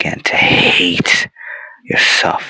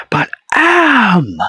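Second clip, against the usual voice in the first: "am" held long on a very high cry; exultant proclamation. Acted. Machine proclaiming its name to captives, soaring cry. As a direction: swell suddenly into a soaring "am".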